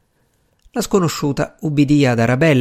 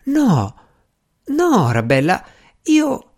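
high, faltering protest in fragments; exclaiming protest; 7 semitones higher, slower than usual. A narrator's reading. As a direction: protest in startled bursts, then break off. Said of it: "esclamò Strange". (protesta esclamata, esitante)

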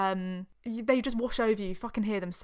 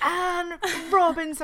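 dramatic announcer; 8 semitones higher, 6 dB louder, slower than usual. Retold or imitated. Dramatic name repeat.

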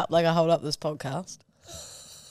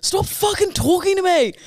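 higher, annoyed bark; snappy retort; 10 semitones higher, 4 dB louder than usual. Retold or imitated. Snapping at an ex-boyfriend while running, higher and louder. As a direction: snap at him mid-run. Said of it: "And I was like".

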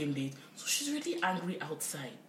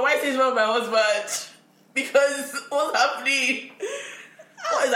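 loud, high, laughing disbelief; hammed-up bewildered questioning; mock alarmed bafflement; 8 semitones higher, 8 dB louder than usual. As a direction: demand it loudly, half laughing. Acted bafflement at being loved.

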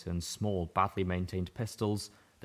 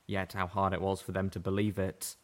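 usual speaking voice

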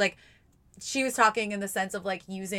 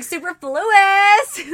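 very high, loud, stretched out; party cheer; 13 semitones higher, 14 dB louder, much slower than usual. Pretend. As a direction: shout it like kicking off a party. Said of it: "sounds like I'm getting ready for a party".

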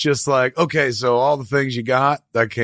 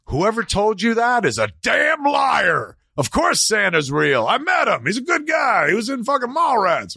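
indignant parent; 10 semitones higher than usual. Retold or imitated. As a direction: overdo the defence of Santa as if insulted. Parent falsely defending Santa.

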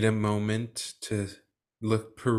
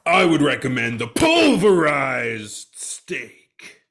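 loud slow recommend; 8 dB louder, slower than usual. Acted. Chef recommending dish.

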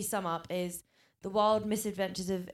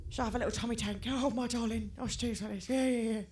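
higher, put-on posh English lady voice; Joanna Lumley voice; 4 semitones higher, 8 dB louder than usual. Podcast host mimicking Vivian's Joanna Lumley, higher posh voice. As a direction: mimic a plummy luvvie cooing darling. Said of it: "did that voice".

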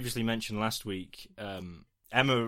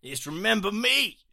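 loud, high hammed-up New York accent; 7 semitones higher, 6 dB louder than usual. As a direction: belt it out as a cartoonish New York boy. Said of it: "shall I do a New York accent".

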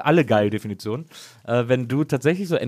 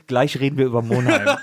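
teaser announcer; 2 semitones higher, 5 dB louder than usual. Pretend. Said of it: "pass auf, kleine Vorschau, Leute".

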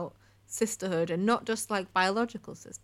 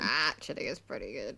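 low grudging witch voice; 8 semitones lower, 5 dB quieter than usual. Pretend. Joins voicing the witch.

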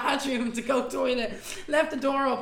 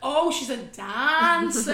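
mock surprise; 4 semitones higher, 7 dB louder, much slower than usual. Imitating surprised acquaintances.

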